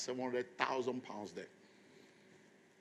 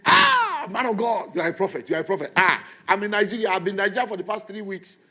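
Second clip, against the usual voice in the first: loud, high, gushing hello; excited greeting; 7 semitones higher, 12 dB louder than usual. Retold or imitated. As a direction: burst out happy to hear from him. Friend answers phone excitedly.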